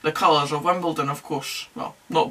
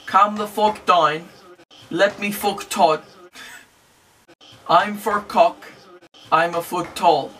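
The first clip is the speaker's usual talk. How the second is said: put-on slow, drawn-out Northern Irish accent, each phrase spelled out; a football vlogger does a deliberately slow Northern Irish mouthing of the ref's words; slower than usual. Northern Irish accent, slow and exaggerated. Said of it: "I'll even say it in a Northern Irish accent".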